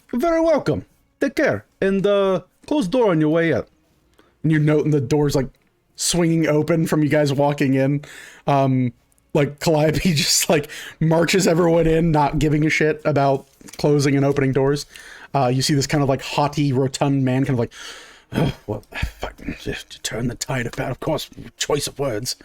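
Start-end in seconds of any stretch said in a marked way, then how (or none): 0.1-3.7 high, lilting marble-mouthed merchant; shopkeeper's cheery send-off. Acted. Shopkeeper dismissing customer, high lilting farewell. 18.3-22.3 soft, grumbling under the breath; huffing mutter. Acted. Haughty man grumbling about choice of words, huffy mutter. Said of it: "he starts huffing out".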